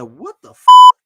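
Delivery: loud, high burst of shock; shocked shout. Retold or imitated. Seeing the quarterback under center, shocked shout. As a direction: blurt it out stunned. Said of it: "I said".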